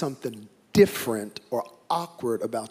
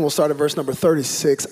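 normal talking voice

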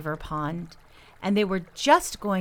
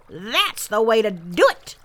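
loud grandpa beaver cheer; cried out; 2 semitones higher, 7 dB louder than usual. A narrator's reading. Grandpa beaver coaching his grandsons to swim, crying out loudly. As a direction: call it out loudly in praise. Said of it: "cried Grandpa Whackum".

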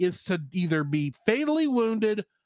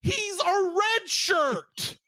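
incredulous; 10 semitones higher, much slower than usual. Retold or imitated. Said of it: "I was like".

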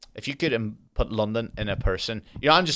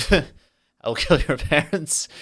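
laughing threat; 4 semitones higher, 3 dB louder than usual. Pretend. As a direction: crack up halfway through the threat. Repeats threat breaking into laughter.

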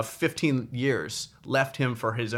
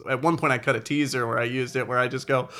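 normal talking voice

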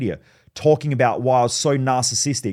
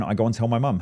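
ordinary delivery

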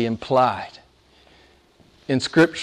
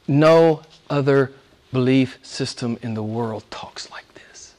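low, soft, with long pauses; hushed, reverent preaching; 4 semitones lower, 8 dB quieter than usual. Real, not acted. Preacher marvelling at a relationship with God, hushed preaching. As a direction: drop almost to a whisper and let it sink in.

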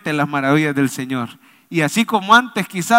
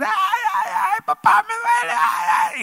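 put-on very high wailing child's cry; screaming little boy; slower than usual. Preacher imitating a boy with earache on a plane, screaming. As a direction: shriek like a kid in pain. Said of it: "empieza a pegar gritos el muchachito".

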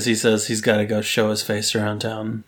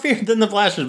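ordinary talk, nothing marked

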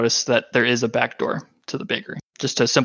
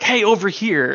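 higher-pitched mock call for attention; quoted "over here" holler, pitched up; 8 semitones higher than usual. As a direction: call out as if waving someone over. Acts out calling for attention.

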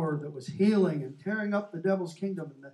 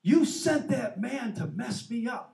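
higher, louder, slow and accusing; retold angry accusation; 8 semitones higher, 6 dB louder, slower than usual. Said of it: "he just explodes".

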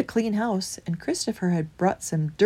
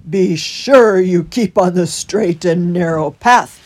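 loud, slow character voice; warning hedgehog; 12 dB louder, slower than usual. A narrator's reading. Holgate sending Christopher onward, loud slow warning. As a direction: warn him slowly and emphatically. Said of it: "he warned".

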